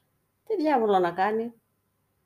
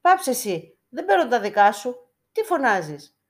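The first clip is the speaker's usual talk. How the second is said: higher and louder, snapping back; angry retort; 5 semitones higher, 6 dB louder than usual. A narrator's reading. The beggar snaps back at the icon, angry. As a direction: tell the voice to shut up. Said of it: "Εθύμωσε ο Λεημονής".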